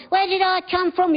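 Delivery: put-on high, curious child's voice; child's question. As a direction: ask it like a small kid. Child voiced in parent scene.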